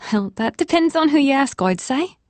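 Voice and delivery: high, lilting Irish waitress; Irish accent. A narrator's reading. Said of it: "Her Irish accent added a note".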